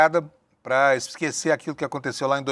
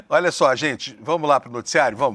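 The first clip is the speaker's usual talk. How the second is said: ordinary talk, nothing marked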